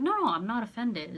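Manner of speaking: high, loud protest; retold flustered denial; hasty reassurance. Hastily denying she was offended.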